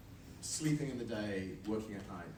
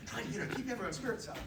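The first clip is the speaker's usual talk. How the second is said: usual speaking voice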